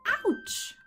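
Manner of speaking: sudden high, loud yelp of pain; puppy yelping after tripping over a turtle. Acted. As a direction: yelp as you trip. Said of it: "Farley yelped".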